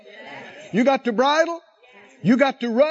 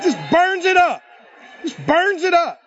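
fired-up boast; 4 semitones higher, 3 dB louder than usual. Shown as a demonstration. Emphatic boast to cheering congregation.

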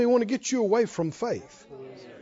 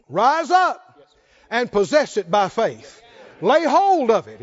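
commanding God; 2 semitones higher, 5 dB louder, slower than usual. Retold or imitated. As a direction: call them to rise up and take hold. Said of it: "And then he says".

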